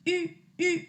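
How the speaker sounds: U francesa; French u. Shown as a demonstration. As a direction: say I with the mouth in a U. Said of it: "Pongo la boca como una U y digo I".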